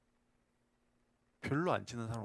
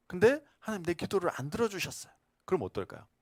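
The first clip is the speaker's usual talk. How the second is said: ordinary talk, nothing marked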